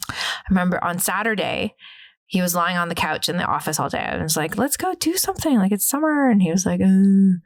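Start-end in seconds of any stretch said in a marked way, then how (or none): 4.6-6.3 much higher, bright coaxing plea; high eager nagging; eager pleading. Retold or imitated. Pleading with lazy partner. 6.8-7.4 lazy grunt. Retold or imitated.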